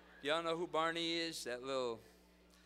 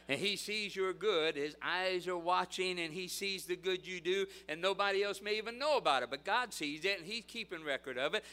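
louder, higher, emphatic pulpit delivery; impassioned preaching; 5 semitones higher, 8 dB louder than usual. Real, not acted. Preacher assuring students God sees, forceful proclamation. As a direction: preach it with rising conviction.

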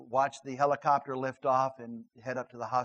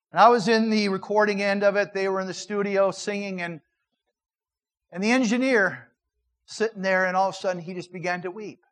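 preaching climax; 6 semitones higher, 8 dB louder than usual. Acted. Preaching builds again.

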